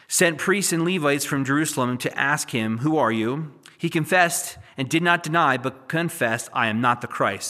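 loud, measured reading of scripture. Acted. Preacher reads Isaiah to his congregation, loud and solemn. Reads John chapter one aloud.

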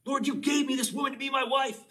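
high, loud mock complaint to the Lord; mock yelling at God. Preacher imitating someone yelling at the Lord. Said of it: "Have you ever yelled at the Lord?".

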